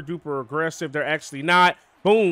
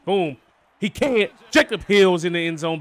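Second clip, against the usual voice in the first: excited; 2 semitones higher, 6 dB louder than usual. Real, not acted. Touchdown scored.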